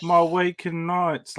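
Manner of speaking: high, drawn-out mock English accent; exaggerated London character impression; Stephen Grant. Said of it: "when we first heard that voice".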